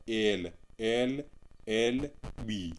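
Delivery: "एल एल बी" spelt out very slowly; letters read separately. Shown as a demonstration. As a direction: draw out each letter of "एल एल बी". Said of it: "आपको अलग-अलग पढ़ना है". ("एल एल बी" एक-एक अक्षर धीरे)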